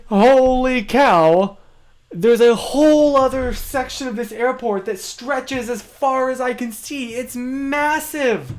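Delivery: high, awed exclaiming; amazed. Real, not acted. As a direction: sound blown away by it. Awed by huge terminal.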